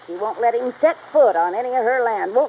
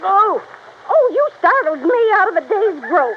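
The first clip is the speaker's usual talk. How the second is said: jumps at the intruder, flustered; startled old woman; 8 semitones higher than usual. Acted.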